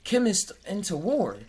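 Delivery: high-pitched disbelief; incredulous question. Retold or imitated. To the viewers, high baffled mock question. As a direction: ask it baffled. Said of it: "I'm like".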